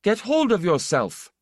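rough, angry order to a panicking friend. Acted. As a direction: force him down with your voice. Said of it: "his voice was very angry".

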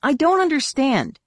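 exasperated. A narrator's reading. Jason failing to get an explanation, exasperated. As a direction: let exasperation push the voice up. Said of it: "said in obvious exasperation".

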